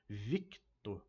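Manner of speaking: "Victor" split as VIK... tor; stressed syllable shown. Shown as a demonstration. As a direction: stretch out "Victor", pausing after the K. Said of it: "Pronuncia-se a sílaba trô-, tônica". ("Victor" com VIK destacado)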